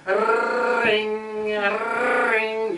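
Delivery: high, rolled-r ring, drawn out; old phone ring. Retold or imitated. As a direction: trill it like an old wall telephone. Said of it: "the old phone ring".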